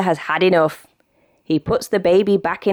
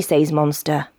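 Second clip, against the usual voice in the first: ordinary delivery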